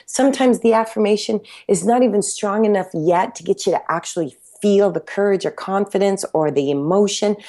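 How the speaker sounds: ordinary talk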